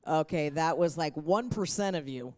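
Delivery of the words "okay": ordinary talk, nothing marked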